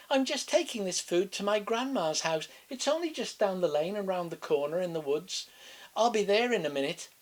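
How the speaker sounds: put-on high, chirpy little girl. A narrator's reading. Girl telling the wolf about grandma, high innocent voice. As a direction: sing it out like an innocent child.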